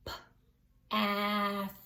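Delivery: P-ath split into its sounds, drawn out; segmented. Shown as a demonstration. Teacher splits path into sounds for kids. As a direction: pull apart P-ath slowly. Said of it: "Say the word path".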